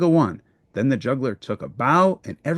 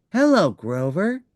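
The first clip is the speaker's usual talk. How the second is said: Big Bird voice; 5 semitones higher than usual. Acted.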